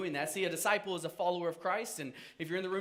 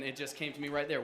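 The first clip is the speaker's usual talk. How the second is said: normal talking voice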